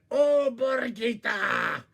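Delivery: loud, high, slow wailing howl; mad howl. Acted. Coyote howling at Borgita, loud and slow. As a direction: wail your anger to the moon. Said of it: "got very mad".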